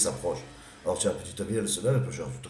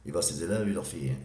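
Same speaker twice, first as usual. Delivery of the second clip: usual speaking voice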